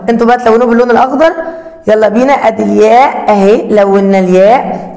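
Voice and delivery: ordinary delivery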